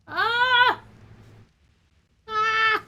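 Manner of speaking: high, loud warning yelp; sharp warning cry. Real, not acted. Owner yelling a warning at a misbehaving pet, sharp cry. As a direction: stop the pet with a sharp shout.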